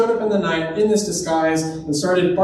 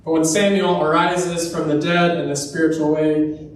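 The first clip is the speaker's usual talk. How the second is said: ordinary talk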